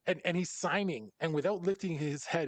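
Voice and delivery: usual speaking voice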